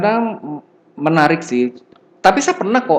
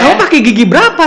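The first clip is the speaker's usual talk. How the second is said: high, loud incredulous question; excited question; 12 semitones higher, 7 dB louder, faster than usual. Retold or imitated. Lecturer asking clumsy student, excited. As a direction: demand the answer in high disbelief. Quoting his own question.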